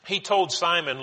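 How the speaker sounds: ordinary talk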